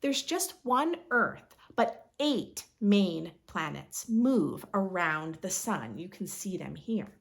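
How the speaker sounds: ordinary talk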